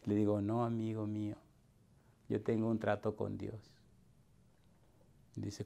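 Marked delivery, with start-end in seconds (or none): 0.4-3.6 quietly, gently declining the money; soft refusal. Retold or imitated. Preacher telling a grateful man he won't take money, softly. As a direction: turn down the gift softly and kindly.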